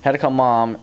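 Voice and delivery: normal talking voice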